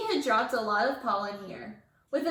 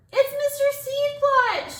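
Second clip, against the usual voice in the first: high, loud, slow alarmed yell; 7 dB louder, slower than usual. A narrator's reading. Phoebe spotting her old teacher, high loud panicked yell. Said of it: "she yelled".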